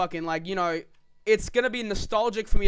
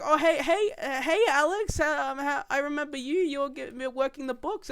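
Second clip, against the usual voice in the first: put-on little kids voice; 5 semitones higher than usual. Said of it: "they're all like".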